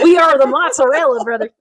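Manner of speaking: exaggerated mock Texas accent. [name] introducing the brothers, hammed-up Texas accent. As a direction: go extreme Texan, amused. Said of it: "[name], extreme Texas mode".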